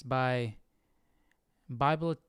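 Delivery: ordinary talk, nothing marked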